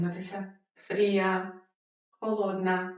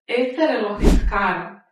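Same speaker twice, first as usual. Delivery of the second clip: loud model read; 8 dB louder than usual. Shown as a demonstration. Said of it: "teacher repeats model phrase".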